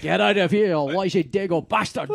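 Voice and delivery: a mock Connery Irish cop's warning; imitating Connery's Irish accent. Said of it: "Or his Irish accent".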